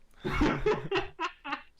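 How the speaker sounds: high, loud real laughter; helpless laughing fit. Real, not acted. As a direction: burst out at the punchline. Laughs at punchline.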